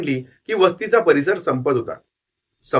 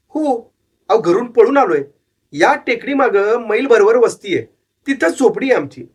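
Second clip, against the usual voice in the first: put-on young runaway boy, higher voice, earnest; boy's voice; 6 semitones higher than usual. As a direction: plead your case to the old man. Boy explains he ran away.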